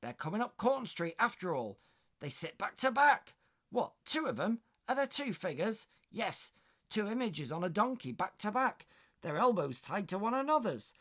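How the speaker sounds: high, loud excited West Country maid; enthusiastic West Country. Acted. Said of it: "more enthusiastically".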